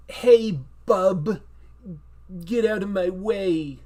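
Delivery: loud, halting tough-guy line; Woody Allen as Wolverine. Acted. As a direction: put on a comedian playing an action hero. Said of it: "Woody Allen voicing, uh, Wolverine".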